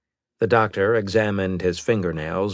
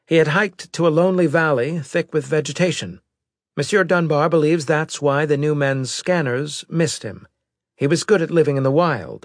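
higher-pitched, louder officer's voice; intelligence officer; 9 semitones higher, 5 dB louder than usual. Acted. Officer briefing the admiral on a survivor, higher-pitched report voice. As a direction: deliver a formal report in a raised pitch.

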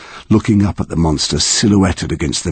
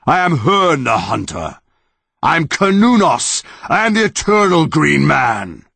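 higher proclaiming monster voice, a touch of a roar; mock roaring monster; 10 semitones higher than usual. Tree monster declaring its names to Connor, grand mock roar. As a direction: announce your ancient names grandly. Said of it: "roared the monster".

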